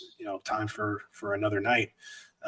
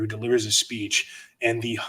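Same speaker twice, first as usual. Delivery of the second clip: ordinary talk, nothing marked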